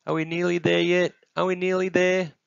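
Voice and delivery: high, loud, sustained whine; insistent high-pitched nagging; impatient little one. Acted. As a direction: pester like a child on a long trip. Impatient child on long walk.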